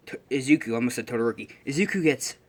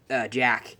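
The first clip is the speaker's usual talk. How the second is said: normal talking voice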